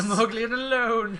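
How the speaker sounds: high, loud whiny voice; echoing Mantis with a loud whine; mock Mantis wail. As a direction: wail it like a sad alien. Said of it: "echoing Mantis line".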